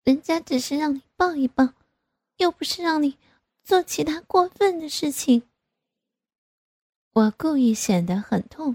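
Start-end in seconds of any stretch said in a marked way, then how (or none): 0.0-5.5 higher-pitched pouty plea; pleading. A narrator's reading. Grown daughter pressing her reluctant father, pleading. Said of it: "我又央求着".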